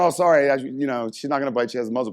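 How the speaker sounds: high, loud rushed reassurance; flustered apology. Retold or imitated. Reassuring the fallen vet that the dog is muzzled. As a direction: apologize and reassure in one breath. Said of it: "I was like".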